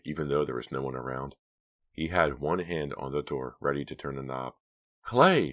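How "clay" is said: high, louder call of his name; calling a little louder. A narrator's reading. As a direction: raise it a notch to get his attention. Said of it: "called a little louder".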